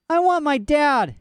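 put-on high, slow, tearful child. A narrator's reading. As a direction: plead for dad, about to cry. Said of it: "she said more loudly".

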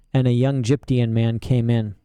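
ordinary delivery, nothing marked

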